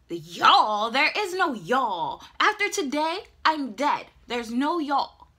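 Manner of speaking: loud, emphatic, incredulous retort; indignant drama. Retold or imitated. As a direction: hammer it out in doomed outrage. Acts indignant retort to mom.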